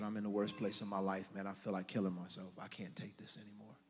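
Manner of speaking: low, hushed confession; retold despair. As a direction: recount hitting bottom, very softly, trailing down.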